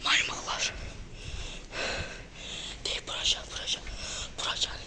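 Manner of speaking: frightened hushed whisper; scared whisper. Real, not acted. Sneaking in the dark, whispering to friends in fear. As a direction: whisper like you might get caught.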